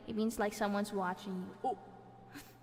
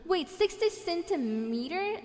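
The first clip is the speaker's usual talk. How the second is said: higher, slow, rising "sixty centimeter"; can't believe the size; puzzled rising repeat; incredulous question; 6 semitones higher, 6 dB louder, slower than usual. Real, not acted. Doubting the size just read.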